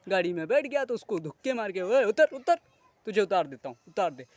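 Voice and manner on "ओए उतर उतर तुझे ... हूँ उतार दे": loud "oye utar" barking a passenger off the bus; mock conductor yell. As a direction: yell like a rude bus conductor throwing someone out. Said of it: "ये situation होती है". (बस conductor की नकली चिल्लाहट, ज़ोर से)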